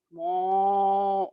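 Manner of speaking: drawn-out monotone mooing; long cow moo. Acted. Storyteller imitating the lazy cow by the gate, long moo.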